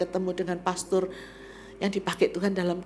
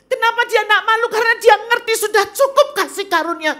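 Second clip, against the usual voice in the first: high, loud pulpit proclamation; full-force preaching; 12 semitones higher, 9 dB louder than usual. Acted. Preacher telling the church of Paul's grace, forcefully. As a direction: drive it home to the congregation.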